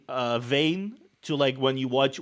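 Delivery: ordinary talk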